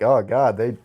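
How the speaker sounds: ordinary delivery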